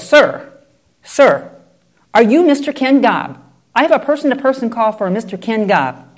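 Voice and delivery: mock impatient operator repeating herself. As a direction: insist with growing impatience. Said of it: "the operator's getting impatient".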